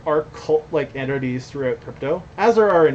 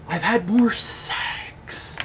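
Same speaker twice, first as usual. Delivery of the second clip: slow, high whispered confession; teasing slow whisper; 7 semitones higher, much slower than usual. Real, not acted. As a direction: breathe it out as a cheeky secret. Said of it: "slow whispered punchline".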